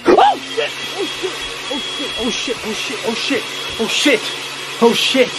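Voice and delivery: high, panicked repeated cursing; truly startled and terrified; real terror. Real, not acted. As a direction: blurt it out in startled, rapid bursts. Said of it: "I'm freaking terrified right now".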